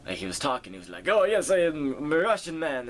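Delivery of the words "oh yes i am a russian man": high, louder put-on Russian accent; imitating a Russian man with a thick Russian accent; mock Russian accent. Retelling a stranger overheard at the airport, mock Russian accent. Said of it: "I heard a Russian guy next to me".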